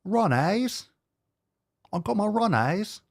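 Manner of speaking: high, questioning mock-Aussie echo; put-on Aussie twang; mock Australian accent. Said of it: "like, Australian influencer".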